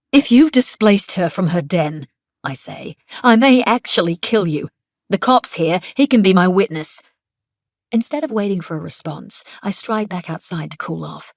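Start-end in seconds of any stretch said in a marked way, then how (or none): 0.1-2.1 angry threat. A narrator's reading. Threatening Niels in anger. 3.2-7.0 angry threat. A narrator's reading. Woman threatening coworker in front of cop, loud and angry.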